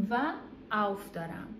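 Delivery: ordinary delivery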